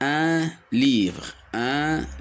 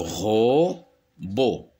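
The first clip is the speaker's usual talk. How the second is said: "robot" split into ro, pause, bot; syllable by syllable. Shown as a demonstration. Teacher demonstrating two syllables, syllable by syllable. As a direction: say "robot" as two isolated beats. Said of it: "deux syllabes".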